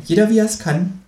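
high-pitched needling creature voice; Känguru. A narrator's reading. Kangaroo needles writer about easy storytelling, high kangaroo voice. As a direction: tease lightly, pitched well up. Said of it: "sagt das Känguru".

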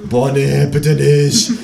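pleading in unison. A narrator's reading. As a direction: beg off with exasperation. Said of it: "sagen das Gespenst und ich gleichzeitig".

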